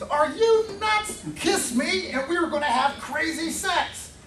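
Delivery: an outraged frog who can't believe it; exasperated frog. Acted. Frog exasperated with the old man.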